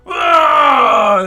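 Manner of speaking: very loud, high drawn-out roar; big pretend-monster bellow; mascot roar. Mascot roars at the adventurers' group, booming. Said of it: "walks over to your group and goes".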